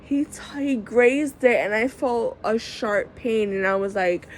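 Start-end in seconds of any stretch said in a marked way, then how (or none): none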